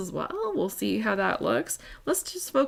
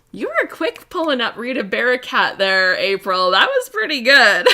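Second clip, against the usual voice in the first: loud, amused praise breaking into laughter; tickled, getting louder toward a laugh; 4 semitones higher, 10 dB louder than usual. Real, not acted. Crafter praising a viewer's quick comment, amused and loud.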